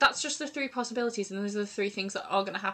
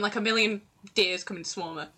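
usual speaking voice

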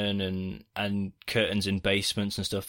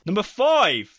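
outraged disbelief; 9 semitones higher, 7 dB louder, much slower than usual. Real, not acted.

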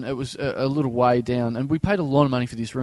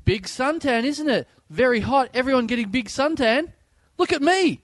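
much higher, broken-English imitation; resort helper's voice; 9 semitones higher than usual. Resort worker joking to guests about the heat, high imitation. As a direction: play the joking helper, pitched way up. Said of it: "And he goes".

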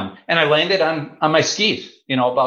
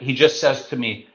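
ordinary delivery

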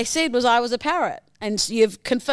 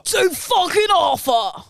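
put-on slow, raised copycat gangster accent; parroted British accent; 6 semitones higher than usual. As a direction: shout back the British gangster accent, drawn out. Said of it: "I can impersonate it flawlessly".

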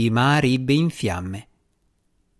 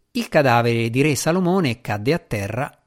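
ordinary delivery

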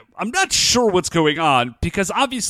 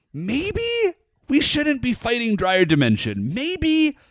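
tentative suggestion; 6 semitones higher than usual. Retold or imitated. Teammate gently doubting whether they should fight the monster, tentatively. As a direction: float the idea carefully, pitched up.

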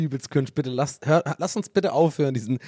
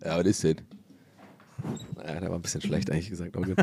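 low voice; 9 semitones lower, 8 dB quieter, faster than usual. Acted. Answering voice, very low.

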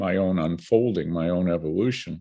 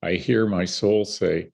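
ordinary talk

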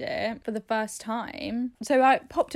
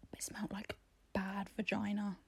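whisper; 2 semitones lower, 15 dB quieter than usual. Real, not acted. Confiding gross detail.

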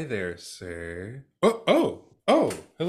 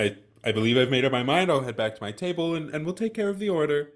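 hurried; 3 semitones higher, much faster than usual. Acted. Hurried exit.

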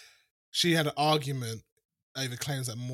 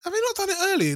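surprised; 5 dB louder, faster than usual. Real, not acted. High-pitched surprised protest.

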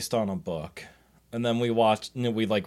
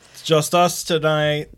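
drawn-out announcement; 6 semitones higher, 7 dB louder, much slower than usual. Acted. Hosts alone, mock spooky.

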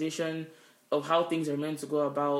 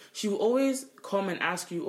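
usual speaking voice

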